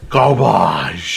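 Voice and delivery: dramatic French garbahzh; French accent. Shown as a demonstration. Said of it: "You gotta say the title all over again".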